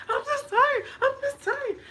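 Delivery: high, loud hammed-up wailing; mock crying. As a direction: wail it like an overdone crybaby. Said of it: "You ever seen somebody crying".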